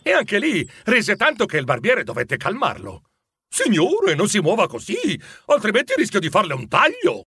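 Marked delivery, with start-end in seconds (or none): 3.5-7.2 high-pitched, urgent protest; alarmed barber. Acted. Barber trying to calm laughing Scrooge, exasperated warning. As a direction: plead with a fidgeting customer, pitched up.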